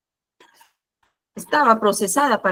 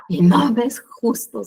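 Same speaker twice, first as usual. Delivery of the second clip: súplica lenta y quebrada; quéjate como quien llora; lamento entre lágrimas; 2 semitones higher than usual. Retold or imitated. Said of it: "Yo lloraba, lloraba". (llorando ante el Señor, lento y quebrado)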